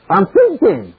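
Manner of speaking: high, protesting comic reply; Jack Benny. Acted. Miser stalling the stick-up man, comic protest. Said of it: "And Benny said".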